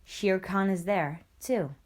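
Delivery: usual speaking voice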